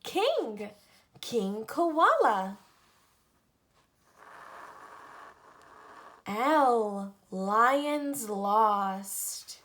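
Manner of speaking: lively picture-book read-aloud, each letter set apart; reading to children. Acted. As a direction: read an alphabet book to little kids. Reads alphabet book to children.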